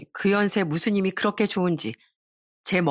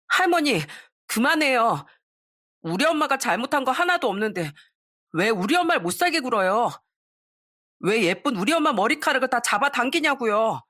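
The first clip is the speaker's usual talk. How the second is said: put-on a boy's raised voice, reproachful toward grandma; resentful boy; 5 semitones higher than usual. A narrator's reading. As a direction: plead with grandma, hurt and reproachful. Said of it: "원망 섞인 목소리로". (할머니를 원망하는 아이 목소리, 높은 톤)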